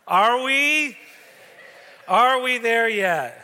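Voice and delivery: put-on high, loud, drawn-out kid whine; whiny kid question. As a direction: whine it like an impatient sixth grader. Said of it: "And he said".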